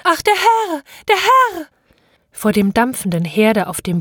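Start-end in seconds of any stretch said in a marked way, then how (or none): 0.0-1.7 very high wail of horror; maid screaming. Acted. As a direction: cry out as the shocked maid, pitch soaring. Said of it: "Das Dienstmädchen schrie". (gellend hoher Entsetzensschrei des Dienstmädchens)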